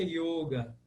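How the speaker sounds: yoga with a closed O. Shown as a demonstration. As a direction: say 'YOH-ga' for yoga. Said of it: "a pronúncia é".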